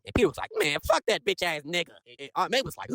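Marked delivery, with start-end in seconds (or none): 0.6-1.9 higher, drawn-out mock contempt; bitter dismissal. Recapper as Peter dismissing Rich to Aunt May, slow higher-pitched contempt. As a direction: drag the curse out slowly.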